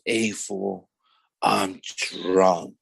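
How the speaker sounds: mild, hushed defeated slur. Retold or imitated.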